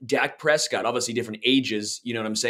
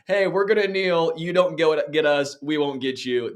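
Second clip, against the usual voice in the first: put-on high Canadian accent, easing down toward the end; mock Canadian accent; 6 semitones higher than usual. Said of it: "that's the most Canadian thing".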